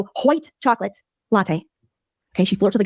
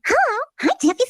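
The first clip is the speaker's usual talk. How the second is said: very high put-on cooing voice; imitating a streamer phoning her husband; mock sing-song caller. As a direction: coo down the phone like a ditzy wife. Voicing Farchado calling husband.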